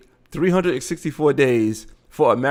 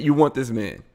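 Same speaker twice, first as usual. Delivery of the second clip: usual speaking voice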